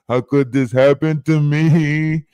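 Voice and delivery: slow whiny mocking voice; drawn-out mock self-pity; mocking whiny apology. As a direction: sneer at their fake remorse. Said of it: "on some weird ass".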